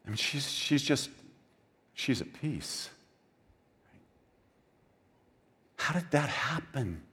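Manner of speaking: soft, slow musing on her peace; hushed wonder. Real, not acted. Preacher softens in wonder.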